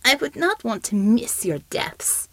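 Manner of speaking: slow, breathy whisper of a gloating shapeshifter; sinister whisper. Acted. Shapeshifter taunting his captors in a slow sinister whisper. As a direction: hiss the mockery slowly, barely voiced.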